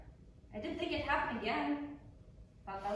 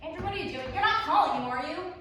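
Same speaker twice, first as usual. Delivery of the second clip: alarmed; 4 semitones higher, 7 dB louder than usual. Acted. Georgie stops him phoning.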